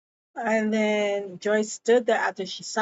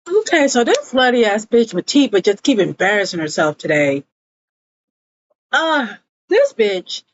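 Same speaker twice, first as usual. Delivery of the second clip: louder, fast exasperated ranting; loud fed-up rant; 7 dB louder than usual. Real, not acted. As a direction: vent your disgust at her. Ranting about Matipa's behaviour.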